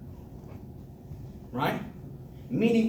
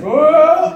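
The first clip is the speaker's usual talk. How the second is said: high, loud mock whoop; over-the-top loud "Oh!"; exaggerated whoosh cheer; 7 semitones higher, 11 dB louder than usual. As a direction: whoop as if celebrating nothing. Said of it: "This person just breathed in oxygen".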